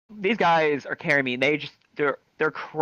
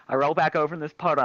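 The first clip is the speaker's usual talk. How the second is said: ordinary talk, nothing marked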